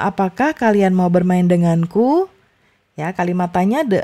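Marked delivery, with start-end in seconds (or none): 0.0-2.3 denganku rises at the end of the question; rising question tune. Shown as a demonstration. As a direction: lift the voice on denganku. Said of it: "di akhirnya itu naik".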